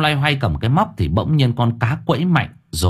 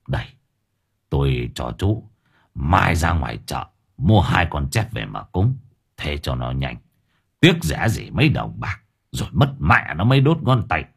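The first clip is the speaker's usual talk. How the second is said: put-on gruff kindly old man; 7 semitones lower, 3 dB quieter than usual. A narrator's reading. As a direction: lower and softer, matter-of-fact. Old man gives money kindly.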